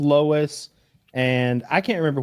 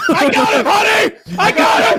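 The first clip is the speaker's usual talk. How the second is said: high, loud shouted call to the wife; triumphant shout; 8 dB louder than usual. Retold or imitated. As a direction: yell it out gleefully across the house. Dad calls to wife.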